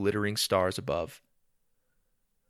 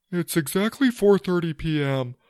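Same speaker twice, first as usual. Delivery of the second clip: higher-pitched character voice; hairy friend; 9 semitones higher, 3 dB louder than usual. Acted. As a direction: voice the massive hairy friend speaking up. Hairy friend reads his watch.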